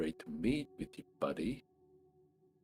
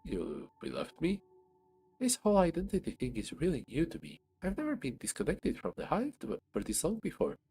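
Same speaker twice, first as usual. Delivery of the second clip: tiny ant voice; 9 semitones higher than usual. Acted. Ant character voice.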